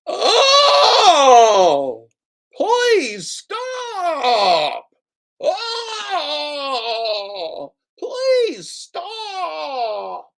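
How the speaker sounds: very high, loud pleading cry; John Billings impression. Streamer doing John Billings begging someone to stop, high shrieking plea. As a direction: shriek it like a man begging for mercy. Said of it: "my John Billings impression".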